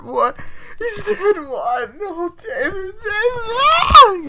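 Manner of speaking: very high, mock-crying shriek; hammed-up hysterical wailing. Emma learns David is dead, hammed-up wailing. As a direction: build from shock to exaggerated screaming grief.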